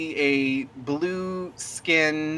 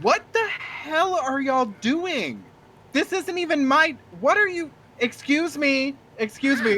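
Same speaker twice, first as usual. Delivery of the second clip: much higher, indignant shopkeeper voice; outraged shopkeeper; 11 semitones higher than usual. Acted. Shopkeeper yelling at the players who broke his shutters, indignant high voice. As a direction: play the startled, offended owner. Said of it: "the shop owner goes".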